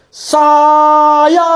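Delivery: SAYA stretched very long on a level tone; "saya" as one long, sustained call; drawn-out name. Acted. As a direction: hold both vowels of "saya" at length. Repeats the stretched-out long name gag.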